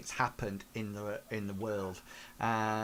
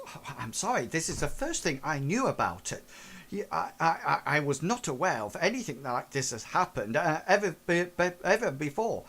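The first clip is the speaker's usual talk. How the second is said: higher, stammering, nervous apology; flustered Mister Gilbertson; 5 semitones higher, 3 dB louder, faster than usual. Acted. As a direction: babble excuses, tripping over the words. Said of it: "Mister Gilbertson says".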